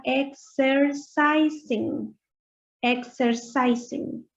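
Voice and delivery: "exercising" drawn out slowly with an er in the middle; slow model word. Shown as a demonstration. Teacher models exercising slowly for students. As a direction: stretch "exercising" syllable by syllable. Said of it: "se lee er".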